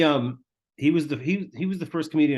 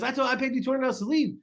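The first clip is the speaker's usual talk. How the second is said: much higher and fast, indignant, squeezed out in a rush; exasperated yell; 12 semitones higher, faster than usual. Retold or imitated. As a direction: fire it off in disbelief. Tim yells at the returning heckler.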